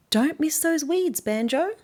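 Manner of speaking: lady beetle voice. Acted. Lady beetle reminding Banjo about weeds, high and slow. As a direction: chirp a reminder. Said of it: "the lady beetle says".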